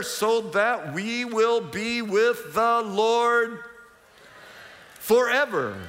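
slow, drawn-out close of the verse; slow solemn verse ending; scripture reading. Acted. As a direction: stretch the promise out slowly.

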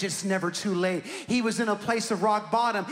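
ordinary talk

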